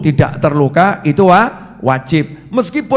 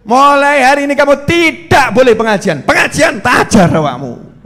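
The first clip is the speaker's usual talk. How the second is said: higher, louder bossy shout; angry order; 7 semitones higher, 4 dB louder than usual. Retold or imitated. Husband shouting orders at his wife, angry. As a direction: shout down the wife's new rule.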